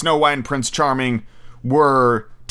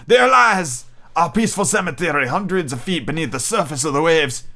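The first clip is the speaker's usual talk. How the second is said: a captain holding back a sob; Captain Nemo; 4 semitones higher than usual. Acted. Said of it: "Then he added".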